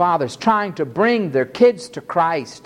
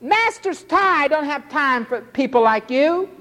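scornful disciples; 10 semitones higher than usual. Retold or imitated. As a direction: bark it like an annoyed gatekeeper. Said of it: "they said".

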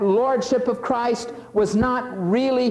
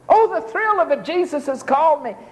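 mock thrilled; 9 semitones higher than usual. Mimicking thrilled disciple.

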